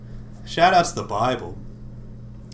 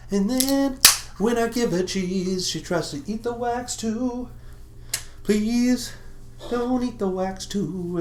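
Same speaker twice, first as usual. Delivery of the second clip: talking to pet; 8 semitones higher than usual. Acted. Scolding pet in cutesy voice.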